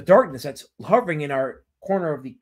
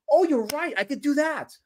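high, acted agreeable reply; imagined agreeable reply; 9 semitones higher than usual. Streamer voices his would-have-been answer, high and agreeable. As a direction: act out a ready agreement, pitched up.

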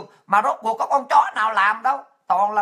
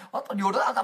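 ordinary talk, nothing marked

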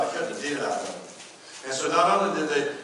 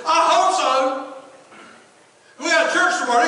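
worried shout; 12 semitones higher, 10 dB louder than usual. Retold or imitated. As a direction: fret loudly about tomorrow's service. Pastor worried about Sunday.

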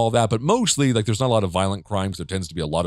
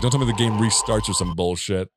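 ordinary talk

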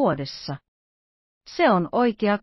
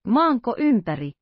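"Maanko ympäri?" raised a little in disbelief; surprised exclamation; 4 semitones higher than usual. A narrator's reading. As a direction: Fix blurts it out, lightly astonished. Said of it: "Huudahti Fix".

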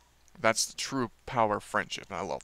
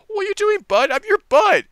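much higher, louder, hurt disbelief; hurt Hiccup; 9 dB louder than usual. Retold or imitated. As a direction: squeak it out like a wounded friend. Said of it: "Hiccup's like".

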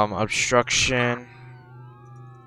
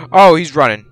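excited shout; 5 semitones higher, 11 dB louder than usual. Real, not acted. Chase starts, shouts out.

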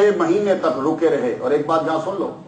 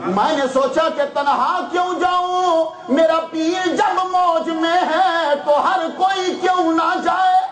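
high, slow preacher's chant; impassioned preaching; 12 semitones higher, slower than usual. Acted. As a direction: lift the quote into a fervent, drawn-out chant. Preaching climax on a quote. (پرجوش خطیبانہ لہجہ)

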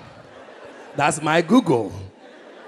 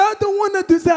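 protest; 11 semitones higher, 4 dB louder than usual. Retold or imitated. Arguing with dad.

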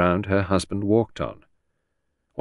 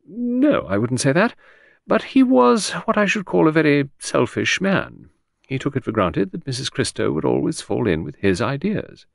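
higher secretary voice, faintly critical; lightly voiced secretary; 7 semitones higher than usual. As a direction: lightly play a candid secretary, pitched up. Secretary's voice in narration.

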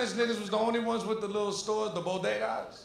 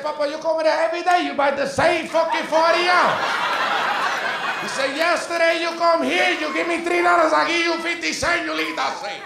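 put-on much higher and louder Spanish-accented rant; shouting bodega owner; 9 semitones higher, 8 dB louder than usual. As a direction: yell at the customer over fifty cents. Bodega owner yelling at customer.

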